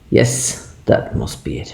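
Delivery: breathy whisper to himself; whispered aside. Real, not acted. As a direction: hush it, as if thinking aloud. Spots the plaque, murmurs.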